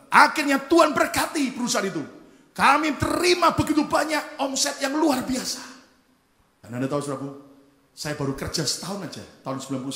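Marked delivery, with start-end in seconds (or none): 0.1-5.6 high, loud testimony of triumph; full-force preaching. Real, not acted.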